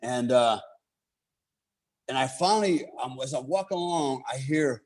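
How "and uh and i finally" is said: usual speaking voice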